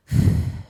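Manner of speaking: a long, breathy, tired exhale; weary sigh. Retold or imitated. Mother fed up with her daughter's lecture: weary sigh. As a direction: let out a put-upon motherly sigh.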